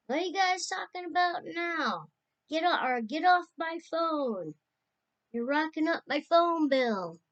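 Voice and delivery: high, loud retold phone interruption; loud, high-pitched playful telling-off; mock grumbling at callers. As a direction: imitate an uncle butting in loudly on the line. Uncle butting into girls' phone call.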